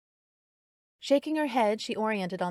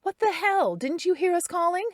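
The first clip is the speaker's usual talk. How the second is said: much higher, louder, exasperated yelling; angry shout; 12 semitones higher, 8 dB louder than usual. A narrator's reading. Carrie berating Darren in the clearing, angry shout. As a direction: let him have it, outraged.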